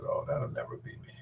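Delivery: ordinary delivery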